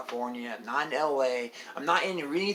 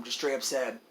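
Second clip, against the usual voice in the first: ordinary delivery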